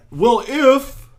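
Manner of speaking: high-pitched put-on query; mocking high voice of an objector; mock questioner. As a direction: imitate someone raising a hypothetical. Mimics a questioner's hypothetical.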